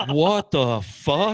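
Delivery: slow, drawn-out laughing disbelief. Retold or imitated. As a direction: stretch it out, laughing in shock. Boat shrinks to a dot.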